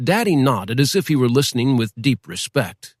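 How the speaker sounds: ordinary delivery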